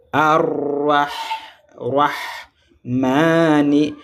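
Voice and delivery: "Ar-Rah", pause, then drawn-out "Rahmani"; teacher showing the word in stretched pieces; model pronunciation. Shown as a demonstration. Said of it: "Regardez comment je prononce".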